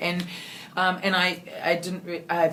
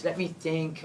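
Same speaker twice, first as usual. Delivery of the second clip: usual speaking voice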